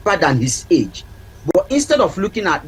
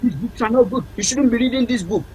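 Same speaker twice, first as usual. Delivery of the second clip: scolding mother; 5 semitones higher than usual. Retold or imitated. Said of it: "But you went".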